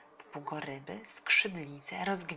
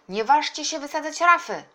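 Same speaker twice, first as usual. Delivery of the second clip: loud, high warning; shouting from afar; 10 semitones higher, 12 dB louder than usual. A narrator's reading. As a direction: forbid them loudly from a distance. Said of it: "wołał z daleka wuj". (głośny, ostrzegawczy krzyk z daleka)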